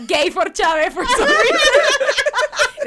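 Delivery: dazzled, laughing it out; laughing confession. Real, not acted. (confiesa riendo, agudo y fuerte)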